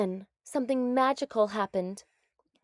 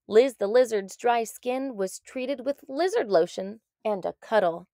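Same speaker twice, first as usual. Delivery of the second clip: usual speaking voice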